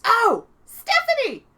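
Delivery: very high, loud, slow delighted hail; excited call. Retold or imitated. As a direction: call out to your hero in delight. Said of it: "she goes".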